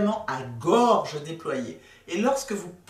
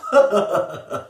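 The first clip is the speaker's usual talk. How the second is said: chest-voice laugh; 7 dB louder than usual. Shown as a demonstration. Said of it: "Je commence grave".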